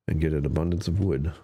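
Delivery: ordinary talk, nothing marked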